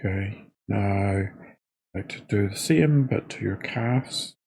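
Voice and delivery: soft, unhurried body-scan guidance with long pauses; guided meditation. Real, not acted. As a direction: talk them gently into noticing each body part, quietly.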